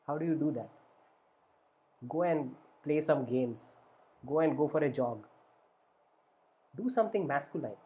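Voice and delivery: ordinary delivery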